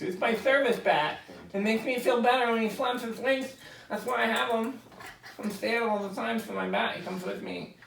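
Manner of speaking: mocking a fussy pet owner on a plane; mock service bat owner.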